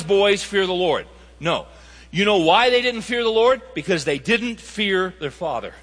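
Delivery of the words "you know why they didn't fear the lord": ordinary delivery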